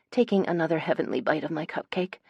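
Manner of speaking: usual speaking voice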